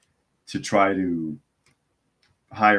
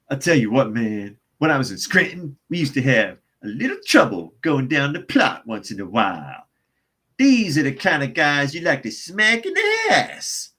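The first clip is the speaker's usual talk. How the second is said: exaggerated mock tough-guy voice, pitched up; Joe Biden impression; 5 semitones higher, 3 dB louder than usual. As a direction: swagger through a hammed-up old politician's brag, stretching the last word. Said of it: "I can't even do a Joe Biden accent".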